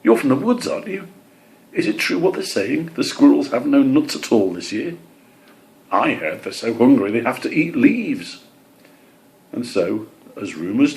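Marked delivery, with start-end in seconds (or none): 0.0-8.4 high, eager bird voice; curious gossiping bird. Acted. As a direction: ask like a nosy little bird. Birds spreading rumours voiced.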